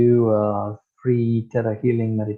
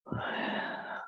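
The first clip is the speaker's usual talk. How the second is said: soft sigh; 13 dB quieter than usual. Real, not acted. Breath before the blessing.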